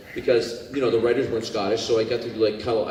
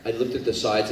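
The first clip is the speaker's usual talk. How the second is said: ordinary delivery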